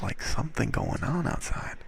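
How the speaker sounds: hushed whisper. Real, not acted. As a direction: keep it under your breath. Whispering about noise outside.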